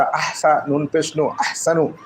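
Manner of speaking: ordinary talk, nothing marked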